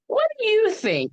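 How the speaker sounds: high, drawn-up challenge to Mom; mock confronting. Mock confronting Mom about movie.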